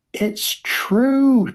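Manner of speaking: very high, insistent exclamation; crying out. A narrator's reading. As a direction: cry it out high, desperate to be believed. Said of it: "Charlie cried".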